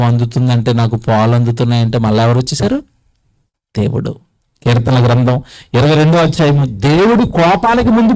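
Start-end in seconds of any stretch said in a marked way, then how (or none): none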